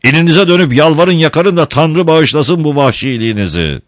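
high, loud scolding shout. Acted. Don Camillo shouting at the mob to be ashamed. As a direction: shame them with a roar. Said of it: "diye bağırdı Don Camillo".

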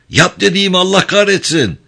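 high, exasperated outburst; angry order. A narrator's reading. Craig to the hesitating driver, angrily insisting. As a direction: swear and insist on being obeyed.

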